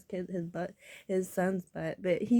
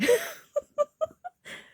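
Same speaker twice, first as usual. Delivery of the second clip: high, loud laughing close to crying; tearful laugh; 7 dB louder than usual. Real, not acted. Speaker recalling being told off for crying, tearful laugh. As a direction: crack up through tears.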